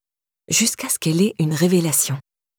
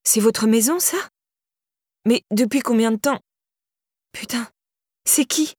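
startled questioning; 4 semitones higher than usual. A narrator's reading. Startled girl questioning Irène.

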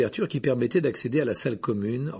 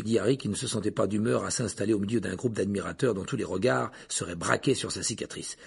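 ordinary delivery

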